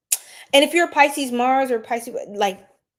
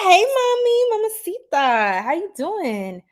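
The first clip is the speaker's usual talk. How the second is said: high, loud flirty hello; flirty greeting; 8 semitones higher, 4 dB louder than usual. Pretend. Greeting a viewer from Brazil, loud flirty call. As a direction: call out to her like a playful flirt.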